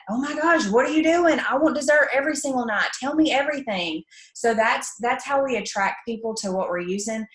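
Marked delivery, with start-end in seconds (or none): 0.1-4.0 high, eager, gushing questions; excited commenter. Retold or imitated. A commenter begging for the secret, high and eager. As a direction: gush like you can't wait to know. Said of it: "People are gonna comment".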